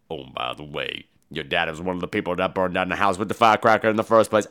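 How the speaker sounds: low, soft storyteller's rumble; deep Tony Todd voice. Retold or imitated. Tony Todd's character tells the backstory, low and soft. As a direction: drop way down and spin the tale low.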